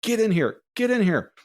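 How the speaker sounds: high urgent call, mildly yelled; retold scream. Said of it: "she's, like, screaming".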